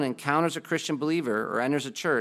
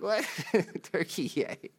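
higher, baffled question broken by a laugh; laughing disbelief; 7 semitones higher than usual. Retold or imitated. Preacher retells reacting to the airline video, laughing disbelief. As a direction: laugh through an incredulous echo. Amused disbelief at odd pronunciation.

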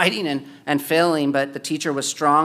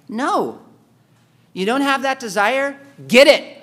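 higher, loud, with a sharp punch on get it; emphatic retort; 7 semitones higher, 5 dB louder than usual. Real, not acted. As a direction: fire back at the excuse. Preacher retorts to the excuse.

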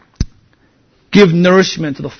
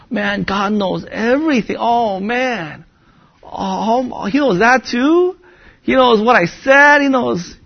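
put-on higher-pitched, dismayed kid realizing he's caught; mock childhood dread; 6 semitones higher, slower than usual. Child hiding in closet from God, mock alarmed. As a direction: play up a child's worried disbelief. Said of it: "I was afraid that God knew everything".

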